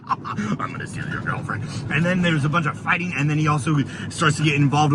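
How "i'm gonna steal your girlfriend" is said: slow, low, breathy whispered taunt; sly whisper. Retold or imitated. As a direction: hush it as a sneaky threat. Hero's reply voiced in retelling.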